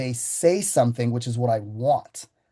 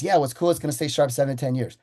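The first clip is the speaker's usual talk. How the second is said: rushed, rattled-off fast pitch; faster than usual. Shown as a demonstration. Rookie salesman rushing warranty pitch, fast. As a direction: hurry through it like an eager rookie. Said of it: "Like, that fast".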